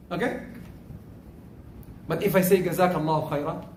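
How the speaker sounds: ordinary talk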